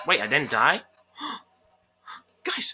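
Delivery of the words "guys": sharp gasp then whisper. Real, not acted. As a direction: breathe out the surprise. Sudden surprised whisper.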